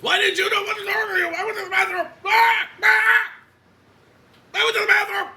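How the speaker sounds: very high, full-volume screamed rant imitating him; mock yelling. Coach imitates colleague blaming her over garbage can, yelling. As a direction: scream it like a man losing it. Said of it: "you're yelling at me".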